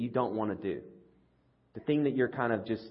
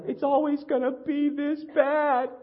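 high, slow whining voice; whiny despair; 14 semitones higher, 5 dB louder, slower than usual. Retold or imitated. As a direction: whine it like a defeated child.

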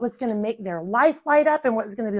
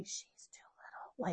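soft unvoiced whisper; whispered reminder; 21 dB quieter than usual. Husband quietly reminding wife about daughter, whispered. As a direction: hush it into her ear. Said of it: "he's having to tell me consistently".

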